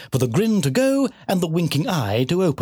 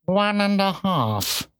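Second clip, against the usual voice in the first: very slow, spat-out cat voice; bitten-off words; 6 semitones higher, much slower than usual. A narrator's reading. Cat explaining queens to the piglet, words bitten off. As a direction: spit out each word separately. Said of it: "seemed to bite off its words and spit".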